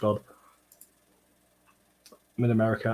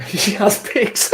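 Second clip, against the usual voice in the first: high, drawn-out laughing exclamation; loud, high, cracking up; laughing disbelief; 11 semitones higher, 6 dB louder, much slower than usual. Real, not acted. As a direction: squeal it slowly through laughter. Cracking up at the revelation.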